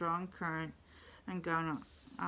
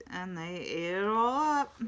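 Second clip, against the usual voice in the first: high-ish squeaky little bear voice, louder; squeaky baby bear; 5 dB louder than usual. A narrator's reading. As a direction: play the small cub, upset. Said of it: "in her high, squeaky voice".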